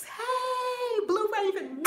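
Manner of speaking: high, sung-out hey to an arriving viewer; gleeful high-pitched welcome; excited greeting. Real, not acted. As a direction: call out a long, delighted hello.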